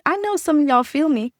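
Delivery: ordinary delivery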